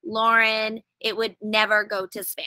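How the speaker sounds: normal talking voice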